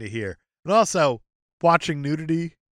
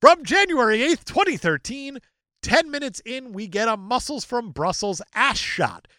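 raised, punchy reading voice; dramatic review read; 8 semitones higher than usual. Acted. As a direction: declaim it high, hitting each phrase. Reads an online review aloud.

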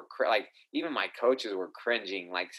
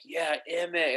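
higher-pitched mock cheer, held back in volume; imitated fan cheer; 6 semitones higher, slower than usual. Coaches cheering their fighter like fans, half-voiced imitated scream. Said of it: "they would scream".

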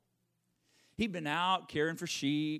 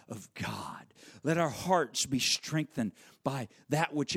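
prayerful; 8 semitones lower, much slower than usual. Acted.

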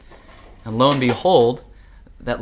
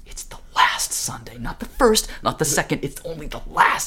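higher, louder, emphatic insistence; mescaline-fuelled freak-out; 6 semitones higher, 5 dB louder than usual. Retold or imitated. Tripping friends decoding the book, urgent amazement. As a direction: hammer each point as a huge revelation. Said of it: "because they're on mescaline, they're like".

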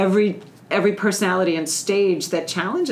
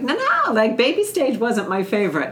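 high-pitched "no, no" in mock objection, then easing; imitated protesting mom; 5 semitones higher than usual. Host voices moms who disliked baby stage, protesting. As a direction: voice a mom pushing back, starting way up high.

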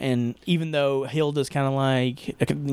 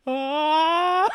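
mock anguished yell; anguished wail; 11 semitones higher than usual. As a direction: let out a half-laughing wail of despair. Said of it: "You're just like".

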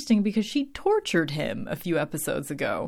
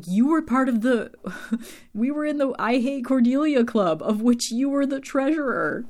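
higher and louder, an indignant retold jab; hurt outburst; 8 semitones higher, 5 dB louder than usual. Willow throwing it in Xander's face, loud and hurt. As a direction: fire it back at him, wounded and loud.

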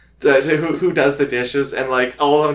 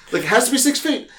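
mock insistence; 6 semitones higher than usual. Insisting on six feet.

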